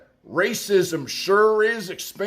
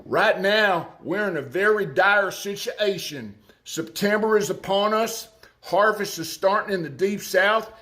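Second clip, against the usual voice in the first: put-on Arkansas accent. Commentator quoting an Arkansas farmer on soybeans, Arkansas accent. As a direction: read it in your best Arkansas accent. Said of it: "in my best Arkansas accent".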